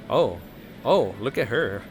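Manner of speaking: ordinary talk, nothing marked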